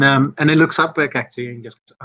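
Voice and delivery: ordinary talk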